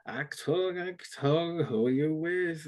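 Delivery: lightly slurred, slow mock-drunk Achtung; sway through it like a tipsy soldier parroting the officer; a hint of a drunk putting on German; drunk acting. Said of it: "some really good drunk acting".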